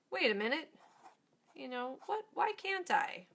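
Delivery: rising high and loud on why can't I; a fed-up part finally objecting; angry protest. Retold or imitated. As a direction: push back against the critic, indignant. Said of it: "It's angry".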